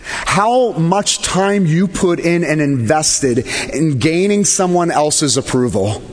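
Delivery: ordinary talk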